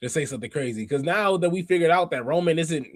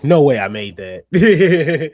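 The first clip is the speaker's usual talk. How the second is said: excited; 10 dB louder, much slower than usual. Real, not acted. Just sprinted back to camera.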